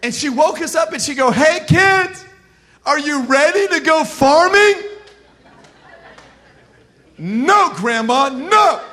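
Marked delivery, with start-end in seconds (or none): 1.3-4.8 high, loud, imitated grandma rousing the kids; chipper wake-up call. Grandma rousing grandkids at dawn to farm, chipper wake-up call. 7.2-8.8 mock protest. Grandkids woken at dawn refusing grandma, mock protest.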